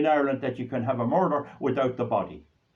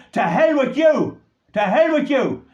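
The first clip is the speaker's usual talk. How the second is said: furious shout; 9 semitones higher, 7 dB louder than usual. Real, not acted. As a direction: shout it at her in real anger. Furious outburst at radio woman.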